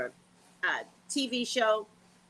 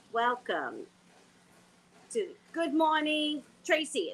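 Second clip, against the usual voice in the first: morning show host; 6 semitones higher than usual. Shown as a demonstration. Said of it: "Let me do my job".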